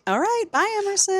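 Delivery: high, softened mock farewell; toying goodbye. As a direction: tease him with a high little bye. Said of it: "toying with him".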